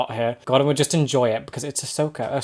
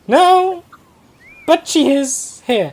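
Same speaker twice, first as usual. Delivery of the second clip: stunned; 12 semitones higher, 5 dB louder, much slower than usual. Real, not acted. Disbelief at reveal.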